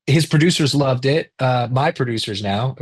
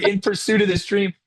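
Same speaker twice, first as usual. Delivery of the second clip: ordinary talk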